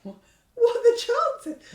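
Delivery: high, loud, drawn-out comic disbelief; mock amazement. Pony looking around at the jump, mock amazement. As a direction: squeal it in hammed-up astonishment.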